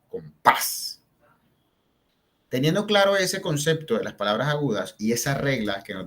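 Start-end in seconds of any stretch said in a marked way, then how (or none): 0.1-1.0 compás split, with the weight on -pás; com... PÁS, hitting the second syllable; stress on last syllable. Shown as a demonstration. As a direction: land firmly on the end of compás. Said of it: "la fuerza está en esa última sílaba".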